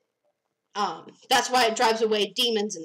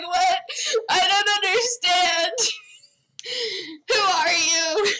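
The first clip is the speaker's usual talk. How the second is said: high, crying bewildered pleading; high confused panic; 8 semitones higher than usual. Retold or imitated. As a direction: plead lost and scared through tears. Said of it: "and she's like".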